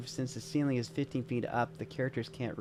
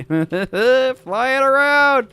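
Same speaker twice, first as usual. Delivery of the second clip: breaks into a laugh mid-sentence, higher and louder; 13 semitones higher, 14 dB louder, much slower than usual. Real, not acted. Prepping a map, delighted the condor can fly, laughing. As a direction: giggle through it, tickled by the idea.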